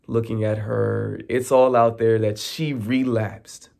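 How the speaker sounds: ordinary talk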